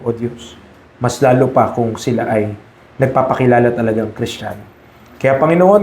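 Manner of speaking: pastor's gentle closing prayer. Acted. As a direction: pray humbly over the congregation.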